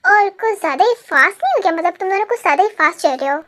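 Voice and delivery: normal talking voice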